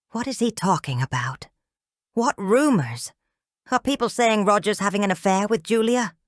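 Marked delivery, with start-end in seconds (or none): none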